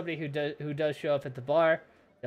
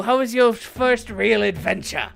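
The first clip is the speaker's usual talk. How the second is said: higher, louder genial aristocrat; effusive nobleman; 6 semitones higher, 9 dB louder than usual. Acted. Nobleman welcomes the adventurers over, effusively. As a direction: play an eager host beckoning guests.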